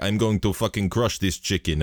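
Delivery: lightly put-on Russian accent; playful Russian fighter trash talk; a hint of a Russian fighter accent; mock Russian accent. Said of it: "doing the accents".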